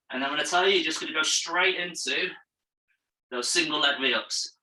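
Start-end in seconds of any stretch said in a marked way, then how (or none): none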